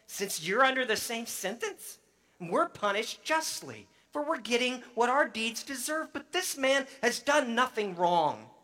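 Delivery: high, urgent stern reproach; rebuke. Acted. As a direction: press the point home to him. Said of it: "the other criminal rebuked him".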